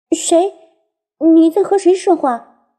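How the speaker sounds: high startled little voice; jumpy and a bit scared; nervous little sprite. A narrator's reading. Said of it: "树精灵紧张地向后退了两步".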